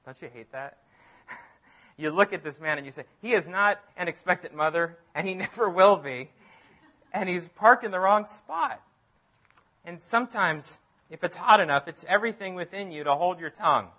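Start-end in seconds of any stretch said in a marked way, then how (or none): 3.2-8.8 mock indignant. Mom scolding the parking guy.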